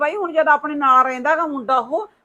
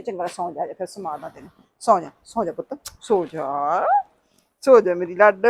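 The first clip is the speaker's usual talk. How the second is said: lower, hushing sleep-now coaxing; mock lullaby coax; 7 semitones lower than usual. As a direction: lull her to sleep, half teasing. Lulling the sulky one to sleep.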